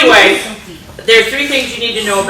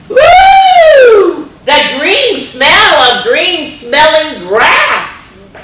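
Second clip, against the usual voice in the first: high loud whoop then slow savoring; high cheerful cry, slow relish; delighted whoop; 8 semitones higher, 6 dB louder, much slower than usual. Acted. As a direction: whoop with joy, then linger. Ruth loving spring smells.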